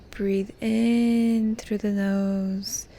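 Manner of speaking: "in" stretched long and swelling; drawn-out breathing cue. Shown as a demonstration. Guide cueing listeners to breathe in through the nose, drawn-out breathing cue. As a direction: pace the inhale slowly, holding the word "in".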